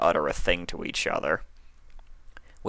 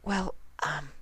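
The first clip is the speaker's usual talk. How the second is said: much softer, high hesitant mumble; bashful reply; 10 semitones higher, 9 dB quieter than usual. A narrator's reading. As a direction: shrink back, embarrassed. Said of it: "Fluttershy bashfully replied".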